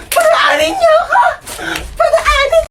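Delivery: high furious shout; yelling to pass. Acted. Stuck behind people, shouting high to be let through. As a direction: scream at them to move aside.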